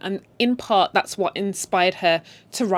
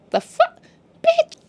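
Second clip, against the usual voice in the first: much higher, louder shocked outburst; outraged disbelief; 4 dB louder, slower than usual. Retold or imitated. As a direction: squeal it in scandalised disbelief. Outraged reaction to husband's side chick.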